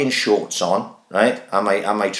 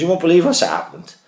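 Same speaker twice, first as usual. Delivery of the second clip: higher, breathless mock wife voice; imitating wife; 10 semitones higher than usual. Husband imitating his wife's phone call, high and excited. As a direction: deliver it like big news, higher pitch.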